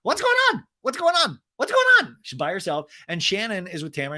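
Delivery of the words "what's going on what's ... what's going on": put-on drunk Vicky. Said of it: "Vicky's like".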